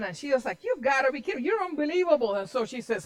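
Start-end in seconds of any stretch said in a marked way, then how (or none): 0.6-2.3 high-pitched indignant complaint; mock exasperation at a husband; acted angry wife. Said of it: "And then she got angry".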